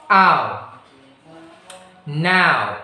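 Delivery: "Now" with a full ow glide; careful "Ow" then "Now"; diphthong demo. Shown as a demonstration. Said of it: "you're going to say now".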